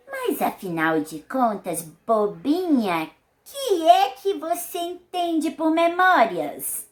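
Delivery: put-on slow, teasing old-lady scolding; Dona Benta's voice. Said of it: "imitando a voz dos personagens".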